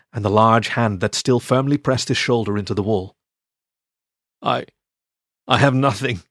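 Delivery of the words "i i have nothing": hoarse croak. Acted. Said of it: "he croaked hoarsely".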